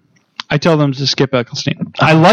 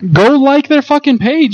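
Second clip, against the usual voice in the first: mock rant; 10 semitones higher than usual. Drunk host rants at listeners.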